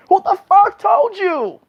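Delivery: high, drawn-out outraged shout; indignant demand. Acted. Girlfriend demanding who told on her, high indignant outburst. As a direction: act out a woman demanding answers, slow and loud.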